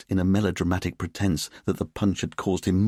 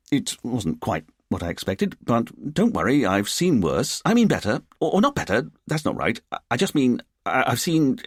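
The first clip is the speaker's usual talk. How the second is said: flustered stammer, climbing in pitch; blustering; 5 semitones higher than usual. Acted. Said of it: "he blustered".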